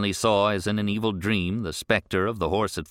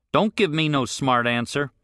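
put-on older rancher, higher and pushier; gruff rancher; 6 semitones higher, 3 dB louder than usual. Rancher questions the boy on the road, irritated. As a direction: demand an answer, irritated.

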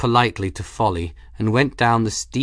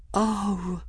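a soft, high breathed sigh; Folly breathing in wonder; 11 semitones higher, 4 dB quieter than usual. A narrator's reading. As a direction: let it out on a breath. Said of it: "Folly breathed".

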